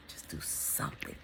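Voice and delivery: a whispered push to act; urgent whisper. Real, not acted. Whispering to viewers to just act. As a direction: breathe it, no voice at all.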